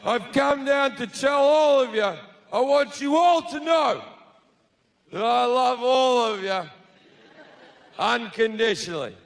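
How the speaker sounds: high, declaiming party announcement; God. Acted. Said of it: "And then God's like".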